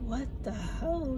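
ordinary talk, nothing marked

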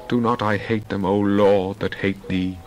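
ordinary delivery

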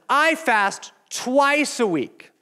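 high, slow, proud bragging; smug slow boast. Acted. Pastor playing the bragging Pharisee, high and slow. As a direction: savor every word of the boast. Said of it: "It says".

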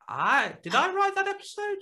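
high, loud, bewildered yelp; baffled shout. Retold or imitated. Writer baffled by fans' question, high loud confused yelp. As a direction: shriek it as if you truly forgot writing it. Writer forgetting his own episode.

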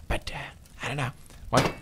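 ordinary delivery

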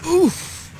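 high-pitched "oof" of shock; high, sudden gasp; startled gasp. A narrator's reading. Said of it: "he gasped".

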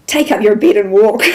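loud, laughing quote of a blunt order; mock-stern command dissolving into a laugh. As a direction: belt it out through laughter. Lisa's blunt command, retold laughing.

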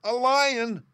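very high, loud mouse-queen squeal; alarmed squeaky cry. A narrator's reading. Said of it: "cried the little Queen".

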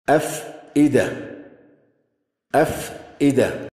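slow, in two separated parts; measured dictation of a word; slow sounding out. Shown as a demonstration. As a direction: sound out أفئدة slowly with a break after أف. Sounding out the written example word.

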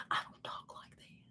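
barely audible whispered protest; whispered denial. Acted. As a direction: whisper it under your breath. Acts out denying her accent.

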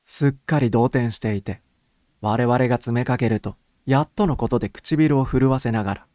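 plain reading voice

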